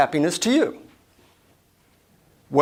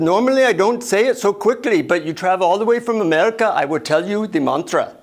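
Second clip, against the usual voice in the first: put-on higher-pitched Indian-accented guru; 4 semitones higher than usual. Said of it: "deep Indian accent".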